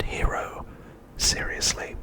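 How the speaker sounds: normal talking voice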